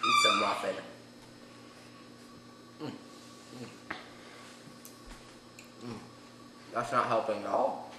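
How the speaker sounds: speaking through spicy burn; mouth on fire. Real, not acted. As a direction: talk with a burning mouth. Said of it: "I don't think I'm gonna be able to talk yet".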